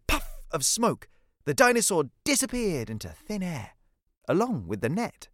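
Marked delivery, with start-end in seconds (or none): none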